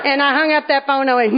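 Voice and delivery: ordinary delivery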